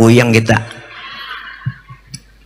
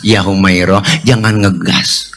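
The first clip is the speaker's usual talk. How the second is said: calming down; 5 semitones lower, slower than usual. Retold or imitated. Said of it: "Nabi nggak marahan".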